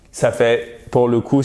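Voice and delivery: normal talking voice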